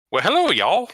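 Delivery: high, loud syrupy Southern greeting; Southern Belle drawl. Acted. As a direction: greet them like a sugary Southern host. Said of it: "Southern Belle sort of character".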